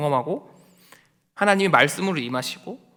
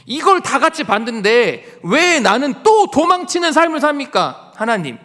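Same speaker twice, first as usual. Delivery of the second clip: loud, high protest building to a cry at God; aggrieved Elijah pleading loudly, pitch soaring; resentful complaint; 5 semitones higher, 6 dB louder than usual. Acted. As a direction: pour out the grievance, voice climbing and pressing. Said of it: "엘리야가 원망하는 거예요".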